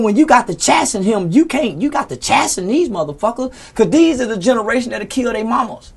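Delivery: usual speaking voice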